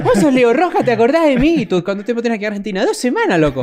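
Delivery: high, hammed-up porteño voice; mock Argentine accent. Friend teasing Leo in an exaggerated Argentine accent. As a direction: tease a friend like an Argentine local. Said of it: "se te pegó".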